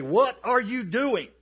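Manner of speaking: loud, high, slow holler; calling out. Acted. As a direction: holler the question slowly at a tombstone. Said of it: "walk up to that tombstone and you say".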